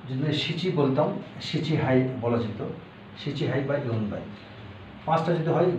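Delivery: normal talking voice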